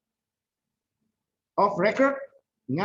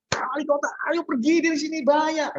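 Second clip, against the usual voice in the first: much higher and louder, urgent alarm; urgent shout; 13 semitones higher, 5 dB louder than usual. Shown as a demonstration. Teacher shows warning the mayor of a fire, urgent shout. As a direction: yell to get the mayor out now. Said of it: "kan nggak mungkin".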